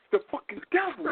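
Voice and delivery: half-joking high holler about the devil; mock preacher cry. Mock preacher outburst, crowd laughing.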